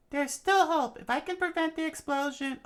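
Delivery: very high falsetto, loud at first; hopeful princess. Acted. Voicing the princess.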